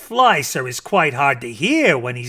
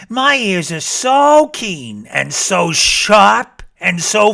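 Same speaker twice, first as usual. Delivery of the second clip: bragging rabbit; 7 semitones higher, 6 dB louder than usual. Acted. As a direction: show off those ears. Rabbit voice held.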